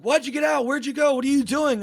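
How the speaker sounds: high-pitched, agitated retold questions; acted frantic questioning. Taxi driver quizzing his fleeing passenger, high agitated questioning. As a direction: imitate an incredulous driver way up high.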